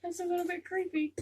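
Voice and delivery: giddy creeped-out squeal. Real, not acted. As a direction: squeal it again, amused and spooked. Repeated, higher and louder.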